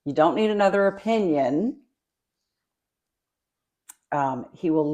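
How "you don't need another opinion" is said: louder, higher-pitched telling-off; emphatic admonishment. Acted. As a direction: lay it down firmly. Continues voicing the verse's commands.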